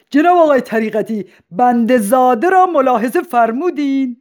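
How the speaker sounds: high, loud heckle from the audience; loud shout. Acted. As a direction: call out loudly across the hall. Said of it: "با صدای بلند داد زد". (داد زدن با صدای بلند)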